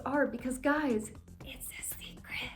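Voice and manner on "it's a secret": whispered secret, no voice. Acted. Telling viewers a secret, whispered. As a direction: breathe it like a secret.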